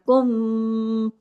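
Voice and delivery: कुम with the मीम hummed long through the nose; held ghunna. Shown as a demonstration. Teacher holding कुम to show the doubled मीम ghunna. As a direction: hold the म of कुम as a nasal hum. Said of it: "यहाँ दो मीम है और तशदीद है".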